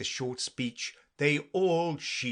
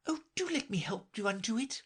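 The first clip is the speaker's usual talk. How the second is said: Alice voice; 11 semitones higher than usual. Acted. Alice offers help.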